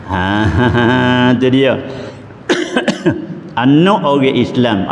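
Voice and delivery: usual speaking voice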